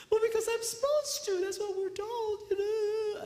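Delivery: a hint of a meek wife, high; high lady voice.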